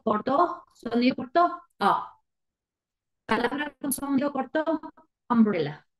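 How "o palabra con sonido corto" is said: usual speaking voice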